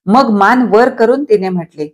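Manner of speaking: usual speaking voice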